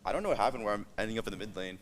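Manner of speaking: usual speaking voice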